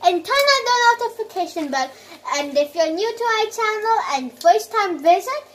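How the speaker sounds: loud upbeat channel sign-off; vlog outro. Acted. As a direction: pitch it like a YouTuber.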